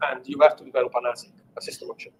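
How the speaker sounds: ordinary talk, nothing marked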